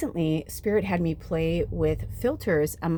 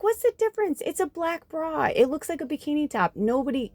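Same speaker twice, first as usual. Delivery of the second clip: coaxing friends; 9 semitones higher than usual. Retold or imitated. Friends talking her into taking her shirt off, coaxing. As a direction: imitate friends in a high-pitched voice.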